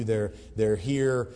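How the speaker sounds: usual speaking voice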